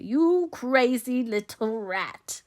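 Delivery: high, loud, drawn-out insult; very slow contempt. A narrator's reading. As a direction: stretch out every word venomously. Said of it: "said Favell slowly".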